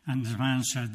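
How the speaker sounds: normal talking voice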